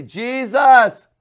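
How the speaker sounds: loud, high, very slow pleading cry; drawn-out desperate shout to Jesus. Shown as a demonstration. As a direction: pray out loud like you mean it. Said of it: "to cry out and say".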